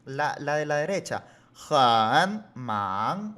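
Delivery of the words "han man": HAN loud, dipping and rising, then separate MAN; a barrier between "han" and "man"; full tones, unchanged. Shown as a demonstration. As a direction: drag "han" fully down-up before "man". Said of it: "hay como que una barrera".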